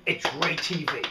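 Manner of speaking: usual speaking voice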